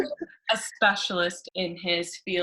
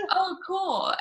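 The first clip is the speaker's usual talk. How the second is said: mock chipper; 10 semitones higher than usual. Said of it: "I'm like".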